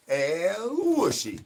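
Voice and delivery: slow high pet call; drawn-out call. Acted. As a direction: croon the name out slowly. Calling the pet.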